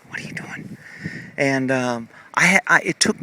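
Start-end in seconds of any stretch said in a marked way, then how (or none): 0.1-0.8 soft whispered question. Real, not acted. Whispering to Buddy beside her, soft whisper. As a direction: breathe it to the horse. Softly greeting the horse.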